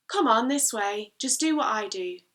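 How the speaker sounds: loud, urging call from a poem; father calling out. Acted. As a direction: call the child on, loudly. Father calls daughter across the water.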